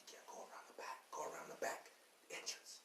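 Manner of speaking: whispered, unvoiced homeowner turning him away; hushed homeowner. Rich homeowner hissing at the worker to use the back. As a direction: breathe it like a nervous rich homeowner.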